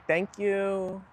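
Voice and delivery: imitating a drawn-out Kardashian thank you; mock Cali girl drawl on the thanks; Kardashian accent. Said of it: "they say".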